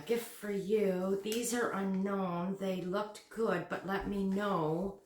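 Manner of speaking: low, soft murmured read-through; reading to oneself. Real, not acted. Vlogger first looks over the note, murmured reading. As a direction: murmur the note as you decipher it.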